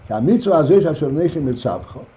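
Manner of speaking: fast run through the Hebrew verse; quick verse recital. Shown as a demonstration. Rabbi repeating the verse in passing, fast and light.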